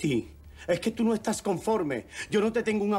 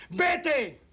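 angry command; 8 semitones higher than usual. Acted. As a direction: throw her out. Man orders her out.

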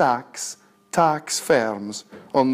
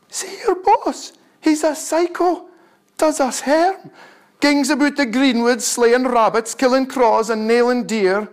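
goller; 9 semitones higher than usual. Acted. The beasts outside the farm shout warnings to the cow, high and strained. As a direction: cry out the alarm about the farmer, high-pitched. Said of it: "They goller all the while and say".

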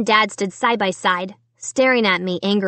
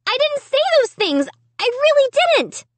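very high, desperate shriek. A narrator's reading. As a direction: shriek it in a high-pitched child's protest. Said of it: "I shrieked".

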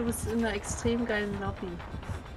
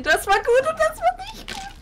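high, loud excited outburst; excited shout; 7 dB louder than usual. Real, not acted. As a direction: blurt it out in a rush. Chaotic game moment.

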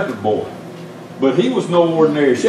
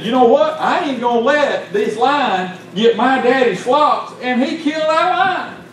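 high-pitched sermon storytelling, rising toward the climax; impassioned preaching; 9 semitones higher than usual. Real, not acted. Preacher tells the congregation of young David's lion, impassioned. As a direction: preach the tale with climbing pitch.

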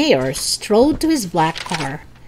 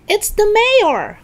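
high, loud, drawn-out announcement; yelling; 5 dB louder than usual. Acted. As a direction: yell the news to everyone. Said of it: "Howie yelled".

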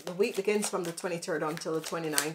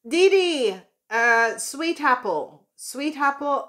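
calling out; 6 semitones higher, 5 dB louder, slower than usual. Real, not acted. Calling out to a viewer.

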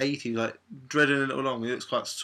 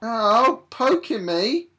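loud, higher hammed-up yelp; mock pain; 10 semitones higher, 8 dB louder, much slower than usual. Friend poked on the arm, yelping in mock pain. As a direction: overplay the little ouch. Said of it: "Oh, shut up, being a wuss".

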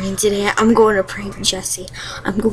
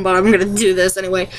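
ordinary talk

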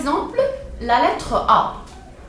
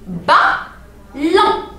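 BALLON in two separate syllables, a clear pause between; découpé en syllabes; 5 semitones higher than usual. Shown as a demonstration. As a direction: say "Ballon" syllable by syllable for young learners. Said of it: "Écoutez bien". (« Ballon » dit lentement, syllabe par syllabe)

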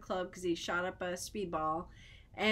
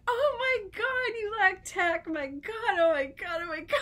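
high, loud "oh my God" reaction; high-pitched, aghast and cracking up; appalled disbelief; 9 semitones higher, 5 dB louder than usual. Retold or imitated. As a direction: reenact scandalized shock, close to laughing. Acted shocked reaction, cracking up.

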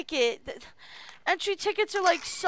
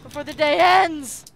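calling out; 9 dB louder than usual. Real, not acted. Impatient for next applicant.

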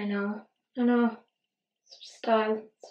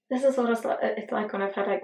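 ordinary delivery

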